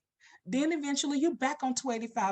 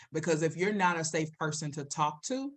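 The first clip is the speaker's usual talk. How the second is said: usual speaking voice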